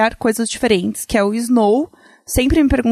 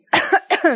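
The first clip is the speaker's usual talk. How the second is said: sudden choking cough; 7 semitones higher than usual. Real, not acted. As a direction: coughs after choking on saliva. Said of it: "eu me engasguei comigo mesma".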